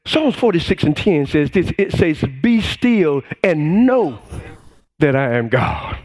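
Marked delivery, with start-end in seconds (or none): 2.4-6.0 slow reverent scripture. Acted.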